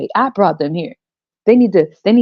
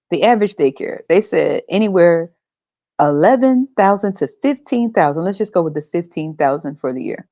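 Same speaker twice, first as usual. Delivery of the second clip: ordinary talk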